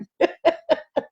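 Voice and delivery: a quick high bright laugh at her own joke; short laugh. Real, not acted. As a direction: laugh it off lightly, amused.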